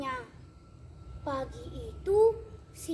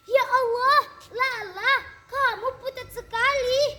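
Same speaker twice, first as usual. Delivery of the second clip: startled gasp, much higher and louder; shocked; 8 semitones higher, 8 dB louder than usual. Acted. Teacher sees pale Lala at the door, shocked. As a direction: recoil in shock at the pale girl. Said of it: "Bu guru terkejut".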